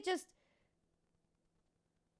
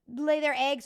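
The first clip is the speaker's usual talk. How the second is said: normal talking voice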